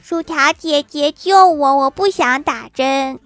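put-on slow, high, pleading child voice; pitiful child. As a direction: beg like a scared little kid. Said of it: "可怜兮兮地说".

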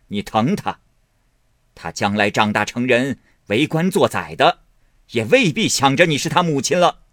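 loud, high sarcastic warning; bitter scolding. A narrator's reading. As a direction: chide her with biting irony. Grandmother bitterly addresses Wang.

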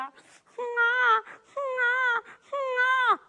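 high, thin infant cries of 哼啊; a storyteller's high newborn cry; newborn baby wailing. Acted. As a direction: wail like a newborn inside the sac. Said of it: "就听见里边".

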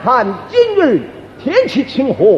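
stylised Peking opera stage speech; opera recitation. Shown as a demonstration. Showing how actors recite about the weather, opera recitation. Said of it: "道白都这么道白".

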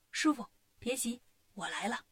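soft whisper. Acted. Wukong buzzing in master's ear, softly. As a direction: murmur it gently into his ear.

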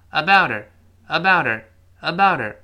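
ABOUT HER run together as "abouder"; T becomes D, H disappears; voiced T linking. Shown as a demonstration. Teacher showing the resulting sound, linked. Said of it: "这么一个声音了".

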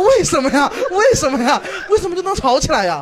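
incredulous giggling questions; laughing at the absurdity; laughing disbelief. Retold or imitated. Retold baffled questioning, laughing.

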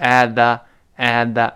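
"at the" with the t swallowed, no burst; unreleased T. Shown as a demonstration. Teacher demonstrates lost plosion to students, silent t. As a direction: tap the tongue for "at", then go straight to "the". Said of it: "失去爆破了".

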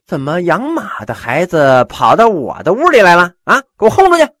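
scornful rage building to a shout; angry shout. Acted. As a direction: throw the stableboy's grandson out. Host orders guest thrown out.

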